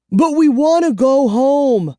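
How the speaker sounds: very high, loud scream; screaming boy. A narrator's reading. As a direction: yell it in despair. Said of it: "I screamed".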